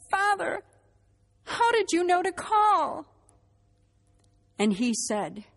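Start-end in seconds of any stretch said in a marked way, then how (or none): 0.1-3.1 high, loud, drawn-out amazement; astonished question. Retold or imitated. Woman asks priest how he knew to call, astonished. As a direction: ask it slowly in raised-voice disbelief.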